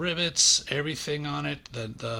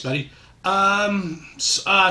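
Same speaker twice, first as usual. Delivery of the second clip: normal talking voice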